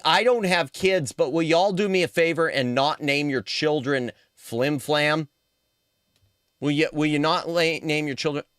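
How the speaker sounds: usual speaking voice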